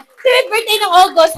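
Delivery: loud call to the room. Real, not acted. Speaker to audience, loud call. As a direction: call it out to the crowd.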